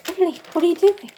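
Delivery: high anxious call; high worried call to a fleeing friend. Acted. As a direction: call after her as she runs away. Carly calls out to Emily.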